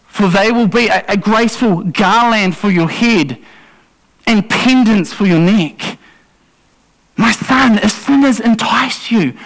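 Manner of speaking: put-on a parent urgently warning a child off danger; urgent pleading. Shown as a demonstration. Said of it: "can you hear the difference".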